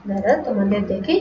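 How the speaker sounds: normal talking voice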